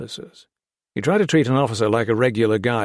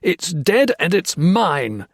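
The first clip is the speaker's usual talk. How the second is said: slow manic menace; 8 semitones higher, 5 dB louder, slower than usual. Acted. Foster insane.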